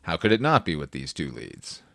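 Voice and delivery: ordinary talk, nothing marked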